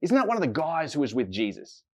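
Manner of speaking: put-on accusing girl.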